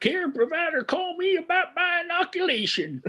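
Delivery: high prospector voice, laughing; cranky old miner, breaking up laughing. Acted. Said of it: "That's my old prospector voice".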